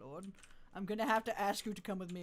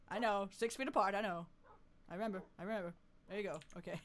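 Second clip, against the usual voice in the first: ordinary talk, nothing marked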